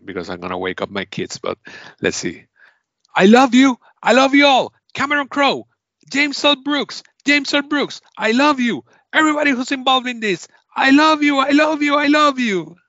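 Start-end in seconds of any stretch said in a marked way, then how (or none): 3.1-12.8 high, loud, exuberant gushing; energetic acceptance speech. Acted. Game host acting out an Oscar winner's speech, wildly energetic. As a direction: pour out love at the top of your voice. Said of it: "as energetic as that".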